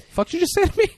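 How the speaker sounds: high, loud offended woman; indignant mock voice. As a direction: snap back offended. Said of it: "she's like".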